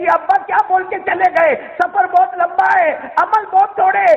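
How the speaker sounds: high-pitched imitation of a grieving cry; preacher acting a daughter's lament; mock wail, pitched high without sobs. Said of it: "رو رو کے کہتی".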